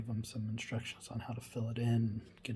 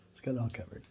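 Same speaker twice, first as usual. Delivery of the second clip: ordinary talk, nothing marked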